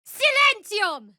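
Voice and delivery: high, loud, slow incantation; commanding high cast; spell shout. Acted. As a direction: bellow the spell word. Casting a spell.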